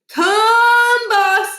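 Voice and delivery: high, loud singsong cow call. A narrator's reading. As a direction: sing out to the herd. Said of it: "said Niles".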